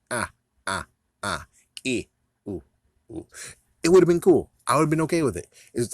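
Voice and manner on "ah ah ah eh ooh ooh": mock Joker laugh. Joker laughing in the corner, mock cackle. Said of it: "laughing, going".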